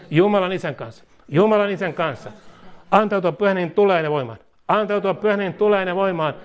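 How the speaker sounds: fervent prayer, each line said twice; led prayer. Acted. Preacher leading listeners line by line in fervent prayer. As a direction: pray aloud so others can repeat. Leads congregation in repeated prayer.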